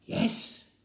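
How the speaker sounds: put-on high, loud child's piping answer; ten-year-old boy voice. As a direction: squeak it out like a small Welsh boy.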